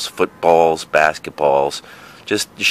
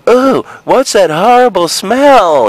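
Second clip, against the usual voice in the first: put-on much higher, louder, slow little-kid voice; 12 semitones higher, 5 dB louder, slower than usual. Six-year-old grossed out by the smell, high and loud. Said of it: "in my kid voice".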